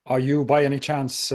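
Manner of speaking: ordinary talk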